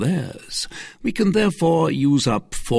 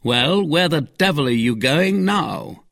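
higher, forceful question; exasperated cry; 8 semitones higher, 4 dB louder than usual. Acted. Athos stopping D'Artagnan at the door, exasperated cry. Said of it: "cried Athos".

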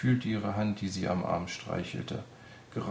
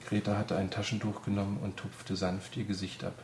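ordinary delivery, nothing marked

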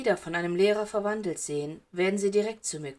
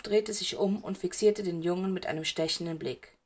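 ordinary delivery, nothing marked

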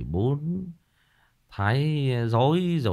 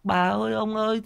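mock mourning cry; 9 semitones higher than usual. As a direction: lightly chant out a pretend lament. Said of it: "Cứ thấy người ta khóc". (giả giọng khóc than)

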